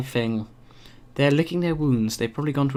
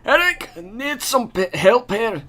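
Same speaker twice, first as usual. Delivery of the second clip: companion voice; 8 semitones higher, slower than usual. Acted.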